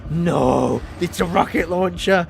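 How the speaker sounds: loud cry of sudden alarm; panicked gamer yell; alarmed shout. Real, not acted. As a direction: shout out as the danger appears. Sudden threat during gameplay.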